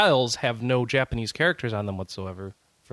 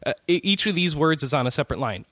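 ordinary delivery

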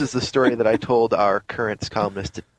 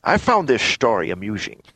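slurred Scottish film-star impression; Sean Connery. Co-host reading the news post on request, Connery impression. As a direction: read it out as a passable Scottish screen legend. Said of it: "a passable Sean Connery for you".

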